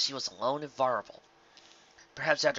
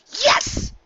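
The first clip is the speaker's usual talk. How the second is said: very high, loud cry of triumph; excited shout; 11 dB louder than usual. A narrator's reading. Rainbow Dash finding the shard, cracking with excitement. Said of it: "she shouted".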